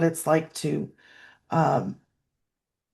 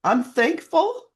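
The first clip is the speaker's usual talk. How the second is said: holding back tears; 8 semitones higher, slower than usual. Real, not acted. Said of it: "I'm not gonna cry".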